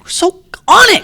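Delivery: very high squeaky mock-excited voice; high giddy mock squeal. Mock excited self-praise.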